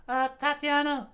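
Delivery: high voice, hesitant; shy girl. Acted. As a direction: explain without pressuring. Character high.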